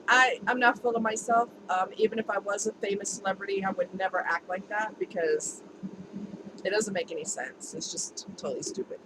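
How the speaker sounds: normal talking voice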